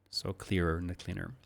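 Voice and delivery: ordinary delivery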